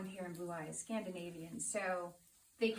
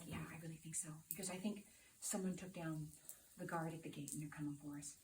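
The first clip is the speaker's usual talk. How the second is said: much softer, tense low-volume reply; hushed and scared; 4 semitones lower, 10 dB quieter than usual. Retold or imitated. Husband fearing intruders at the gate, hushed worry. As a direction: keep it down so no one hears. Husband whispering while hiding.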